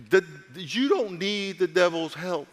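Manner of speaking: ordinary talk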